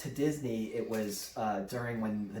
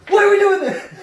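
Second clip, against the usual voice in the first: high, loud imitation of a panicking rider; panicked screaming; 15 dB louder than usual. Brother as soon as the ride starts moving, panicked screaming. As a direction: yell in mock terror, laughter breaking through. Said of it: "he starts screaming".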